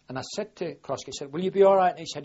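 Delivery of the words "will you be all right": higher and much louder, concerned call; calling to a mate. Retold or imitated. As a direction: call back over the waves to check on him. Asking wounded mate before swimming.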